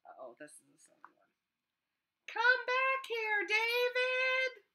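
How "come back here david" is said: high, loud, slow call after a runaway; fed-up mom yelling down the street. Acted. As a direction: drag it out like a mom chasing her kid. Mom calling after running child.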